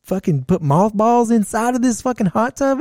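high, incredulous outrage; disgusted disbelief. Retold or imitated. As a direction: gag at the mothball smell. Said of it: "And I was like".

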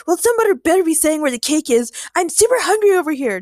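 loud, high hungry whine; complaining. A narrator's reading. Chad interrupts James about the cake, complaining. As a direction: complain loudly that you are starving. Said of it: "Chad complained".

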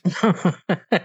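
short, high-pitched laugh; real amused chuckle. Real, not acted. Host saying the kid deserved a punch, amused chuckle. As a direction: chuckle at your own verdict.

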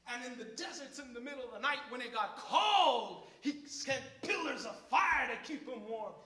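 loud, rising, shouting preacher; full-force sermon climax; fiery preaching. Real, not acted. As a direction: preach it out, building up.

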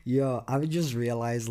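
usual speaking voice

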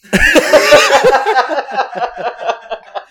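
loud long cackling laugh; high burst of laughter. Real, not acted. Laughing at his own gross discovery, loud high cackle. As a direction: howl at your own punchline.

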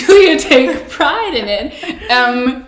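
high, loud laughing speech. Real, not acted. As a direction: laugh through the joke. Joking, laughing with co-host.

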